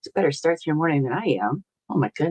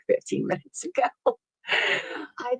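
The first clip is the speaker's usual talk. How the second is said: laughing; 9 semitones higher, 5 dB quieter, slower than usual. Real, not acted. Laughing at waking up late.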